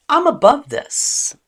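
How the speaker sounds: high, loud, haughty put-down; lofty above-it-all tone; superior. Shown as a demonstration. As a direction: sarcastically look down on it. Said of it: "Sarcasm subtly communicates superiority".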